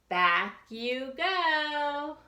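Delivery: slow, drawn-out back you go; slow refrain. Acted. Narrator sending Deanie back to the tub, slow. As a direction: stretch each word.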